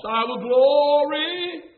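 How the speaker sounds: long, drawn-out preacher's cadence; slow, almost sung proclamation; exultant declaration. Acted. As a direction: stretch the words out in praise. Preacher draws out quoted declaration.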